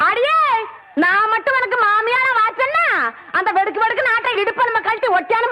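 high-pitched rising warning; quarrelsome threat. Acted. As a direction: warn her with the pitch up. (சண்டைக்கு இழுக்கும் மிரட்டல் குரல்)

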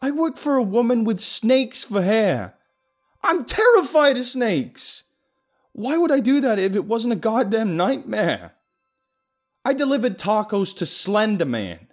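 loud, high frantic rant; ranting yell. Acted. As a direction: rant at full volume, unhinged. Paranoid rant at friend.

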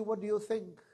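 normal talking voice

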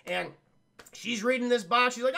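ordinary talk

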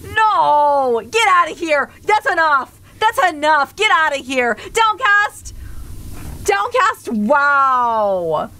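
high, indignant yelling; mock outrage. Streamer scolding Downcast over bad jokes, mock-outraged cry. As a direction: banish them in theatrical protest. Mock banishing joke sender.

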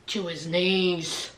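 a slow, hushed low punchline; deadpan low drop. Acted. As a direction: let it fall low and slow. Gossip punchline dropped low.

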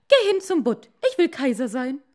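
shrill demand, full voice; commanding wife. A narrator's reading. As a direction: bark the order at him. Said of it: "wife demands emperor".

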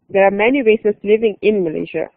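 ordinary talk

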